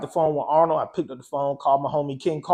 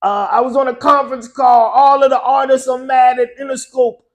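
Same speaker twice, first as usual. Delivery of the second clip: put-on high, loud Caribbean-accented phone talk; Caribbean accent, louder and higher; island accent; 8 semitones higher, 7 dB louder than usual. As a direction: do the island DJ breaking news, pitched well up. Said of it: "So he still got that accent".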